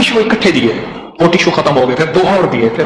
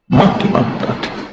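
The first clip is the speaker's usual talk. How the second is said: hushed echo; 8 semitones lower, 4 dB quieter, slower than usual. Real, not acted. Repeated refrain, softer whisper-like.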